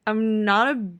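put-on slurred, grinning drunk voice; drunk slurring. A narrator's reading. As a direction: slur it like you're tipsy. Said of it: "Keely slurs and grins".

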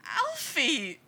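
high, loud plaintive cry for Alfie; almost wailing, a kid on the verge of tears; loopy boy calling for Alfie; near wail. A narrator's reading. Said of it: "the boy almost wails".

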